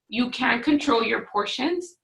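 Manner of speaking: normal talking voice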